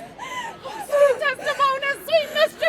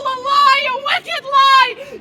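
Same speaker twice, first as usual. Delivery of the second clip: loud, slow, hammered accusation; furious denunciation; 2 semitones higher, 6 dB louder than usual. Acted. Wife denouncing her husband's lie, furious. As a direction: pound each word into him.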